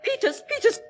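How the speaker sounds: high, urgent begging; frightened plea. Acted. Mother begging the butler to break the door down. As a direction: beg the butler in panic.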